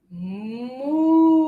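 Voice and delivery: long, drawn-out moo; slow imitation of a lowing cow. Shown as a demonstration. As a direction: moo like a cow, holding the vowel. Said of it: "And the cow".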